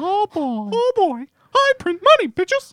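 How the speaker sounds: very high squeaky cartoon mouse; Mickey Mouse voice. Host doing a high squeaky Mickey Mouse for laughs. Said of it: "Mickey Mouse just sits around and goes".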